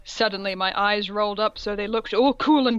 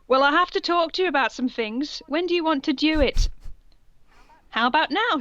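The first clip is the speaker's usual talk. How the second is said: perky teacher voice; 6 semitones higher than usual. Acted. Voicing female teacher.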